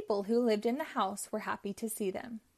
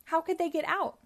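ordinary delivery, nothing marked

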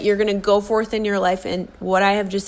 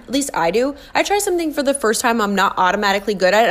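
ordinary talk, nothing marked

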